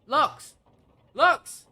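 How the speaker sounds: high, loud yelp of a name; calling out. Real, not acted. Streamer calling Lux over a shout out, loud call. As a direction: call sharply for attention.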